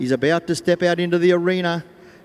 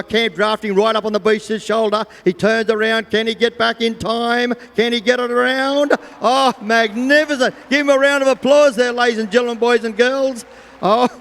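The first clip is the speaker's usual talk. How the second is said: rising, louder race-call excitement; excited commentary; 6 semitones higher, 4 dB louder than usual. Real, not acted. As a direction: call the finish as it lands. Live finish of a camp draft run.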